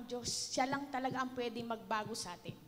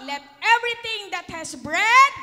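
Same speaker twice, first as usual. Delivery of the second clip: proclaimed loudly; 7 semitones higher, 13 dB louder than usual. Shown as a demonstration. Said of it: "ulit po".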